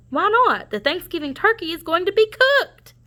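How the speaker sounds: high urgent warning; high alarmed turkey. Acted. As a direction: pitch up, sound the alarm. Cassie warns about turkey's fate.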